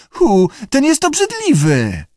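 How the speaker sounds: high-pitched disgusted outburst; disgusted exclamation. A narrator's reading. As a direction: recoil in disgust, pitch leaping up. Keeper disgusted in acted dialogue.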